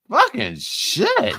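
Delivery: drawn out, high and slow; exasperated drawl. Real, not acted. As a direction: stretch it out, exasperated. Exasperated at the video.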